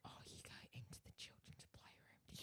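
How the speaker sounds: hushed secret whisper; playful stage whisper; conspiratorial whisper. Pretend. Mock secret whispering about safe.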